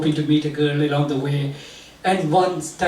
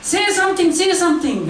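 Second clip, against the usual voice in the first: high, urgent inner voice yelling the order; inner voice screaming; 13 semitones higher than usual. Retold or imitated. Inner voice screaming at the narrator to speak up. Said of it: "this voice screams in my head".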